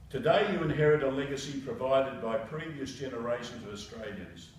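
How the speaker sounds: official message read aloud; formal address to new citizens. Acted. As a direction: read the minister's words formally. Reads minister's message.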